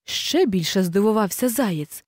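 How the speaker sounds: plain reading voice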